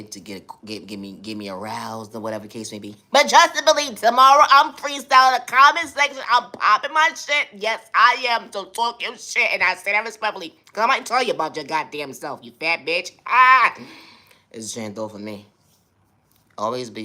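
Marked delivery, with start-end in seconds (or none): 3.1-13.1 much higher, loud put-on voice throughout; hammed-up high-pitched trash talk; sassy persona voice. 13.2-13.7 loud high-pitched mock squeal; cartoonish shriek. Shriek ending the rant.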